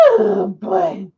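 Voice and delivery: normal talking voice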